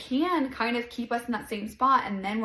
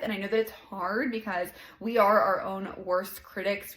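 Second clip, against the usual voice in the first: ordinary talk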